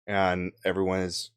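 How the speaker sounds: ordinary talk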